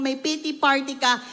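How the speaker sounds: ordinary talk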